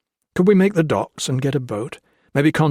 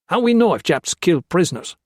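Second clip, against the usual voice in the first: high, raised voice in broken English; a wary bandit testing the stranger; suspicious guerrilla; 7 semitones higher, 4 dB louder than usual. Acted. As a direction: guerrilla challenging the king. Guerrilla bargaining.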